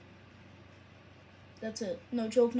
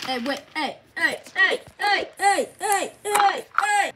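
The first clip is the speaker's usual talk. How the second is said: repeated yelps climbing higher and louder; urgent calling out; 4 semitones higher, 7 dB louder than usual. Acted. Engine trying to stop someone, mounting loud calls. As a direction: keep calling, more urgent each time. Acted calling out, rising alarm.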